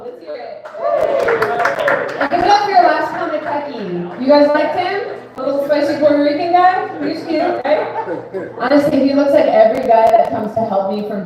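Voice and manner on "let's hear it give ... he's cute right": emcee hype. Acted. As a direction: pump the room up. Host hypes crowd.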